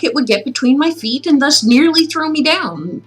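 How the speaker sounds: ordinary delivery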